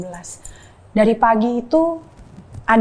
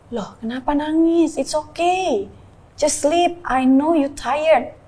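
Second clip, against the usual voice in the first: higher, drawn-out soothing voice; lilting, concerned reassurance; retold coaxing comfort; 5 semitones higher, much slower than usual. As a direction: coax someone who is crying to rest. Retells soothing her crying husband.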